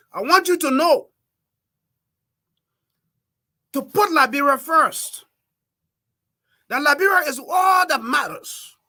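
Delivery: loud, high political exhortation; forceful plea, pushed high and loud; rallying appeal. Real, not acted. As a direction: raise the voice to rally voters. Rallying voters to put country first.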